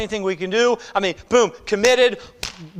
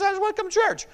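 mock churchgoer; 13 semitones higher than usual. Mocking lax churchgoer's wish.

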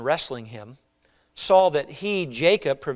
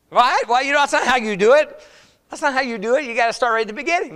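higher, louder, mocking tone; mock know-it-all objection; 9 semitones higher, 6 dB louder than usual. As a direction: play the smug critic who knows better.

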